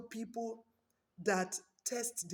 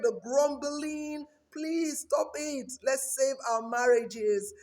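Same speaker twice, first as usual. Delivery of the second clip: raised, higher preaching push; 5 semitones higher, 5 dB louder than usual. Real, not acted. Preacher urging viewers to save their marriages, raised and fervent. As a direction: press the congregation to stop fighting.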